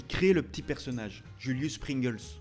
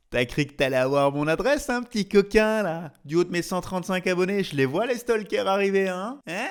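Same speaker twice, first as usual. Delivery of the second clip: loud, higher-pitched teasing of a sneaky viewer; mock taunt; 5 semitones higher, 6 dB louder than usual. Vlogger to would-be stalkers after his address, mock taunting. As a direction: play at catching out the stalkers, pushed loud.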